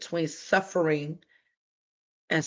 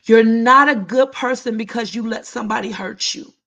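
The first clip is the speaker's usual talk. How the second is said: higher and louder, pressing the point; repeated louder; 5 semitones higher, 7 dB louder than usual. Real, not acted. Host repeating a hard truth to chat, louder and pressing. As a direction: say it again harder so it lands. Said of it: "I'ma say it again".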